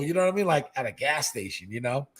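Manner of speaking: usual speaking voice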